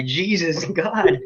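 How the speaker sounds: laughing through the words; amused, incredulous laughter; real laughing disbelief. Real, not acted. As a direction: break into a laugh mid-exclamation. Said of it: "I'm laughing because".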